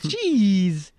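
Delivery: high, loud gleeful cry; playful glee. Acted. Cracker fan playing along with the teasing, gleefully. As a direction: belt it out with exaggerated delight. Echoes the gleeful imitation back.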